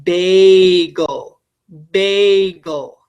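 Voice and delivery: "bagel" with a long stretched first syllable; "bagel" pulled apart into two syllables, bay-gul; syllable demo. Shown as a demonstration. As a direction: say "bagel" slowly, drawing out BAY before a short gul. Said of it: "put it under your chin, and you go".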